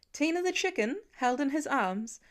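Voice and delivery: ordinary delivery, nothing marked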